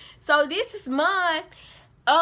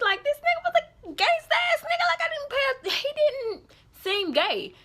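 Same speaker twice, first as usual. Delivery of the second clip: disbelief; 14 semitones higher than usual. Real, not acted. Telling viewers she never suspected, high shocked exclaim. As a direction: pitch it way up in shock and shout it.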